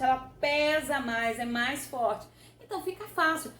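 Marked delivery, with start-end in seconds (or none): none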